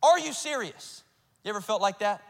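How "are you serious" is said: slow, raised-pitch disbelief; exasperated outcry. Acted. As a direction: throw it up at God, drawn out and incredulous. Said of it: "just yelling and chucking stuff up to God".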